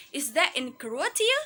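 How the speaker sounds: checking question, lifting "Croatia" upward; rising tone. Shown as a demonstration. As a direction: pitch sweeps up through "Croatia". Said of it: "We read the Croatia with rising tone intonation".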